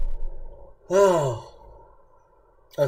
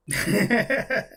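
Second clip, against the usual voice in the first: amused, higher-pitched chuckle; real chuckle; 6 semitones higher than usual. Real, not acted. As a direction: laugh it off. Laughs after losing subscribers.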